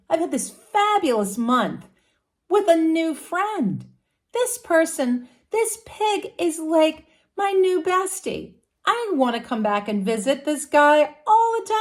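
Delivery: high, gushing over a new friend; celebratory dragon. Acted. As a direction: dragon thrilled about his new bestie, voice climbing high. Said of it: "The dragon feels celebratory".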